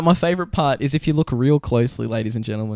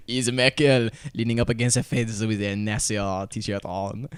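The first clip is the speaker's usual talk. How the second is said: put-on seductive French accent; 2 semitones lower than usual. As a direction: purr it in a sultry French accent. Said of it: "seductive French voice I possibly can".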